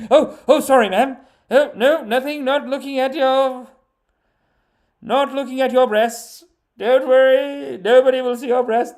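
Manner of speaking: high, loud, fumbling apology; hammed-up embarrassed blustering; flustered men. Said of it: "all the men are like".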